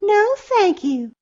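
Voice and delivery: high, slow, loud refusal; firm little girl. A narrator's reading. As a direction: draw out a high-pitched polite no. Girl refuses the bully in story.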